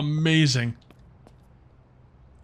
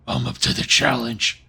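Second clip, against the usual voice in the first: lower, slow Batman voice; 3 semitones lower, 3 dB louder, slower than usual. Acted. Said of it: "I say it like Batman".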